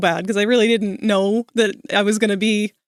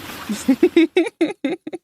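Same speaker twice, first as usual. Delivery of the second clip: bright laugh up high; 7 semitones higher than usual. Real, not acted. After joking about the chiropractor, a high laugh. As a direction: laugh at your own joke.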